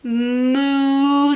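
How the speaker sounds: long, level mooing; drawn-out playful moo on one note; cow moo. Pretend. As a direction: imitate a cow for small children. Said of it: "says the cow".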